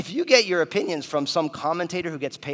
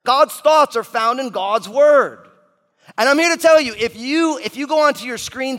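booming preaching; 7 semitones higher, 8 dB louder than usual. Acted. Refrain repeated at full force.

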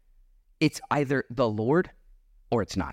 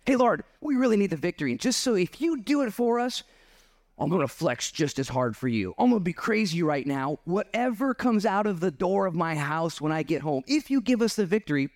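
high-pitched, eager plea to the Lord; lightly acted deal-making with God; bargaining prayer; 7 semitones higher than usual. Acts out Jephthah's bargaining prayer.

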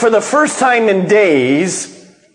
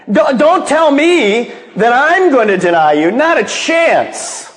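boastful protest; 7 semitones higher than usual. Retold or imitated. Peter boasting to Jesus.

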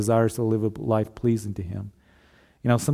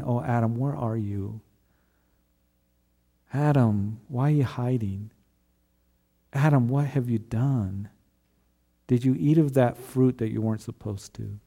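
broken-hearted father. Retold or imitated. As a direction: plead gently for a lost son. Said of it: "a broken-hearted father saying".

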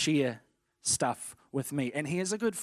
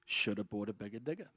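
low, soft "bigger digger", lifting at the end; starting off slow; 5 semitones lower, 8 dB quieter, faster than usual. Retold or imitated. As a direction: ease carefully through "should have bought a bigger digger". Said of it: "So let's start off slow".

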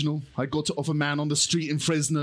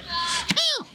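squeaky yelp; 13 semitones higher than usual. Pretend. Puppet hurt.

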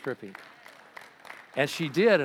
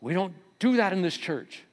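stern usher; 6 semitones higher, 3 dB louder than usual. Retold or imitated. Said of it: "The guy said".

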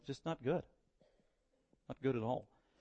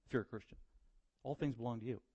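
softer repeat, letting it sink in; quiet repeat; 3 semitones lower, 8 dB quieter, faster than usual. Shown as a demonstration. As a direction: say it again softly to let it land. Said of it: "So he's being emphatic".

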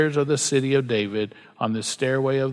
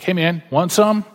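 higher, louder friendly offer; imitating friend's offer; 5 semitones higher, 5 dB louder than usual. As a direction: offer it like a generous buddy, voice lifted. Imitates friend eagerly offering food.

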